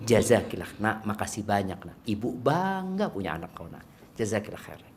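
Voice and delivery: put-on soft, low thanks to a child; gently voiced grateful parent; appreciative mother. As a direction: tell your child softly how proud you are.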